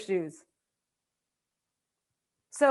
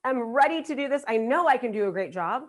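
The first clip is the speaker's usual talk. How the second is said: usual speaking voice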